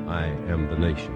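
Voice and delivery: ordinary talk, nothing marked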